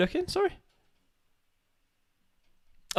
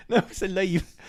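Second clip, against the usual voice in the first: put-on accidental Irish accent; 6 semitones higher than usual. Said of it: "Now I'm sounding Irish".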